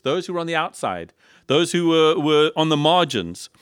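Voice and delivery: usual speaking voice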